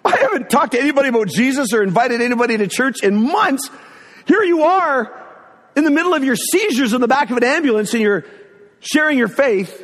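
high-pitched, incredulous rant; amazed disbelief. Retold or imitated. Husband marvelling at his sick wife, incredulous.

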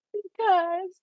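high, tearful, choked-up retelling of a sob; bawling. Retold or imitated. Retelling how she broke down crying in front of her teacher, tearful. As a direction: squeeze the word out as if crying. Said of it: "I'm like, I'm bawling".

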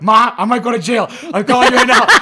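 high, loud mock panic with laughter; teen calling mom, hammed-up laughing fright; retold panicked call. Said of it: "Right away, I freaked out".

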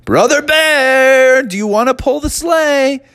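high, singsong, slowly stretched name; drawn-out coaxing call. Acted. Friends coaxing a hibernating bear, high drawn-out call. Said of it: "he was in deep hibernation".

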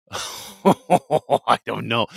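laughing through the words; real laugh, slow and drawn out. Real, not acted. Laughing at Randy's question.

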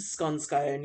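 "Scone" like "gone", then "scone" like "bone"; regional variants. Shown as a demonstration. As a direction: echo both ways of "Scone, scone", short then long o. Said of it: "regional differences in pronunciations".

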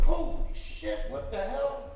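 high loud breathy gasp of disbelief; shocked bystanders. Retold or imitated. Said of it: "people would go like".

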